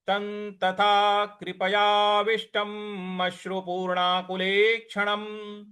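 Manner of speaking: slow, reverent Sanskrit recitation; verse recitation. Shown as a demonstration. Teacher recites the complete verse for students to repeat. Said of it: "इस प्रकार से इसका पूरा उच्चारण किया जाएगा".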